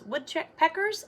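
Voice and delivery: usual speaking voice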